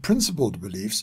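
normal talking voice

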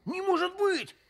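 high, astonished protest; incredulous. Acted. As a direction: blurt it out in disbelief. Disbelief at discovery.

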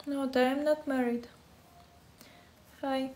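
ordinary talk

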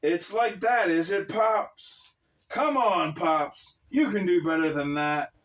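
high, loud taunting shout at the sky. Acted. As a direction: bellow a taunt upward. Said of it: "shouted at the sky".

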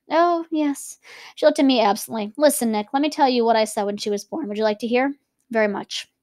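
reading a novel aloud, character lines lightly voiced; story narration. A narrator's reading. Teacher reading Gatsby's dinner scene to students, measured narration. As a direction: read the book to the class.

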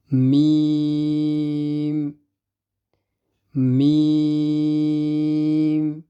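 slow, humming মিম; Arabic mim. Shown as a demonstration. Teacher reciting mim to students, slow hum. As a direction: hold the মিম long. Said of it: "teacher reciting letters".

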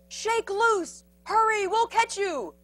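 high, urgent encouragement; shouting. A narrator's reading. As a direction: call up with urgency. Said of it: "the prairie dog shouted".

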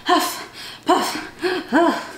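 breathy huffing with a laugh; playful out of breath; mock panting. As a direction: puff it like you are climbing. Climbing back over mountain.

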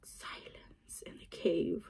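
slow whisper of an empty cave; hushed whisper. Pretend. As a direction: breathe it out slowly, hushed. Acting the cave's silence.

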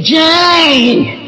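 long, high-held call of the name; Grandma calling her. Retold or imitated. Grandma greeting grandchild at daily visit, drawn-out call. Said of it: "Grandma looked at me and said".

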